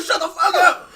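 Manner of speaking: high, loud mock yell; yelling at the bird. Friend yelling at a tweeting bird, loud high shout. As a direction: bark it at the noisy bird. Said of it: "He sounded like Joe from".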